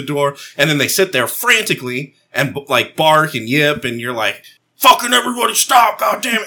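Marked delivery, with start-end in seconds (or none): 4.8-6.5 high-pitched dog-owner outburst; exasperated yell. Retold or imitated. Owner yelling at barking dogs at the back door, exasperated yell.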